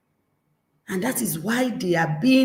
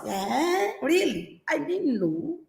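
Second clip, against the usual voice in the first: mocking mock-surprise, raised and soft; sarcastic child; 6 semitones higher, 4 dB quieter than usual. Child mocking a parent's declaration, sarcastic. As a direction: feign surprise to poke fun. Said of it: "child mocking the parent".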